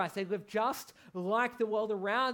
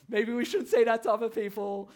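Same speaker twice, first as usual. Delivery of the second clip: laughing; 2 semitones higher, 10 dB louder than usual. Real, not acted. Laughing at son copying him.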